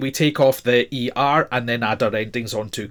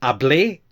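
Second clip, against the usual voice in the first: Hablé rising on its last syllable; final-syllable stress. Shown as a demonstration. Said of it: "with the stress on the A".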